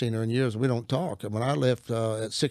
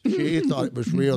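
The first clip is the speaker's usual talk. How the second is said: ordinary delivery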